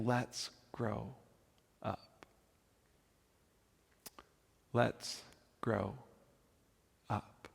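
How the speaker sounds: soft, slow, nearly breathed, words spaced apart; gentle hush. Acted. Paul gently urging the church to grow up, hushed and slow. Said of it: "This is Paul's gentle way of saying".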